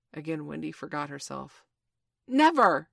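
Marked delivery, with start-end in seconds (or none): 2.3-2.9 loud, high outburst; defiant cry. A narrator's reading. Wendy forgetting herself at the pirates' plan, crying out. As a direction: cry out in sudden refusal. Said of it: "she cried".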